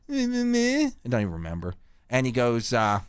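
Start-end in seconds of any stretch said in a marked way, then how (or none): 0.1-0.9 mocking kid babble. Mocking the kid's reply.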